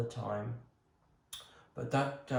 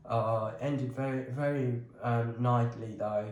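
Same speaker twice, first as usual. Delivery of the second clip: usual speaking voice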